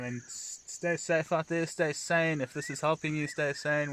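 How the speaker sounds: ordinary delivery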